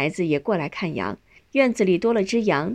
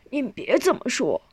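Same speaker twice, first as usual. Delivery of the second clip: holding back tears, a little higher; tearful; 5 semitones higher than usual. A narrator's reading. Son comforting his dying mother, tearful. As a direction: gently protest through tears. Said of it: "少元含着泪说".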